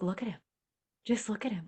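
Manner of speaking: put-on soft, hushed wonder over the baby; tender awe. Mother gazing at her newborn son: softly marvelling. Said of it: "you were like".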